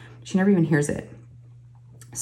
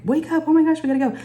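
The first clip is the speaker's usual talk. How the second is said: panicked wake-up call; 10 semitones higher, faster than usual. Retold or imitated.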